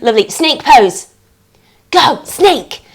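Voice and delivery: usual speaking voice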